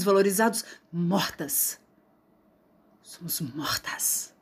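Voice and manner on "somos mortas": slow, breathy whisper, much softer. Acted. Poet whispering that women are dead. After "mortas", quiet grim echo.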